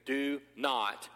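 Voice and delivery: the angel calming Mary, slow and gentle. Acted. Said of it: "the angel he says to Mary".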